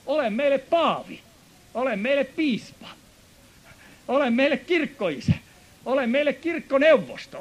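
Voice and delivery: fervent preaching. Acted. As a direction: plead it for the crowd like a revival preacher. Voices Israelites pleading in sermon. (kiihkeä saarnaääni)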